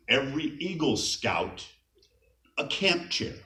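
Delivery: normal talking voice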